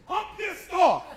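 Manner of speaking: high, loud put-on caller voice; mock angry caller. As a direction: huff it out as the irate caller.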